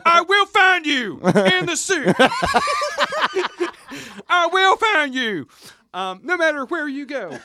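high, drawn-out movie-hero farewell, hammed up; melodramatic plea. Hero begging a companion to save the soup, melodramatic plea. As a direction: plead like a mock dying hero.

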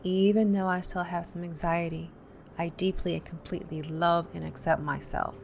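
calm, paced guided tapping with pauses between points; guided tapping script. Acted. Tapping coach to viewers, calm guided script. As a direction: lead the viewer through tapping points and phrases to repeat.

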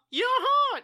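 put-on very high, loud childish comeback; bickering imitated high retort; mock insistence. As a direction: squeal back the contradiction. Acts out childish back-and-forth insistence.

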